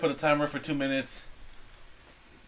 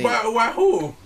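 put-on Chinese restaurant accent; 7 semitones higher, 6 dB louder, slower than usual. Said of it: "Why do you sound like the guy at the Chinese restaurant?".

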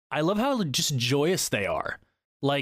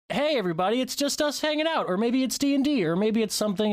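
high-pitched, breezy host intro; casual show greeting; 11 semitones higher than usual. Acted. Host mimics the Tuesday episode hello to listeners, high breezy greeting.

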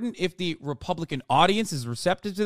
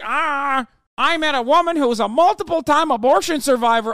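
higher, louder dopey candidate voice; mocking impression; 9 semitones higher, 7 dB louder than usual. Mocking the candidate's debate anecdote, high and loud. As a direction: parrot his debate line like a dim bobblehead. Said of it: "He's like".

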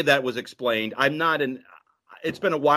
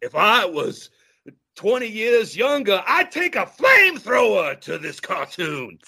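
higher, shouted Pacino rant; 7 semitones higher than usual. Pretend. Still doing Pacino for his co-host, shouting a mock threat. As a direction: rage like Pacino at a bad cartoon.

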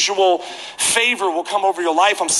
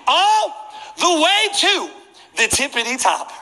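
high, slow holler up the stands, easing at the end; drawn-out distant call; 7 semitones higher, slower than usual. Retold or imitated. Usher calling to a fan far up the seats, high drawn-out call. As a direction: stretch each word so it carries.

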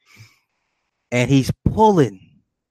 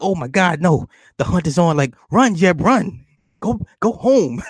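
high-pitched mock alarm; mock panicked urging; 7 semitones higher than usual. Viewer urges Jeb to escape the hunt, high mock panic. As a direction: urge him to flee, pitch climbing.

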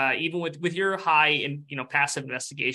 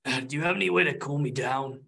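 weary lava ghost; 2 semitones lower than usual. Acted. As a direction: drag out each word, listless. Lava ghost speaks slowly.

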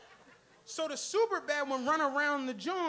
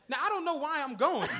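ordinary delivery

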